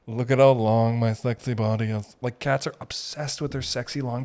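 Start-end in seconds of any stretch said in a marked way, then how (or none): 0.1-2.2 posing cat. Acted. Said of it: "They're always just like".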